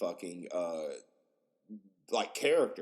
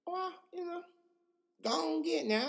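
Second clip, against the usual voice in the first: high-pitched, folksy Southern officer; mock Southern lawman, pitched way up; creepy Southern cop. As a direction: shoo them off in a high cop voice. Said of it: "these motherfuckers just be like".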